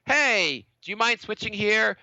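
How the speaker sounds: normal talking voice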